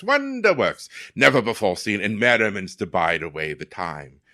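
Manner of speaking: a hint of an old English town-crier accent; light Renaissance faire English. Shown as a demonstration. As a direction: proclaim to the crowd like a faire herald in a mild English accent. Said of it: "let's see, I can do it".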